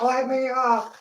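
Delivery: high mock cry to be let up; whiny pinned boyfriend; playfully pleading to get up; mock plea. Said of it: "he's like".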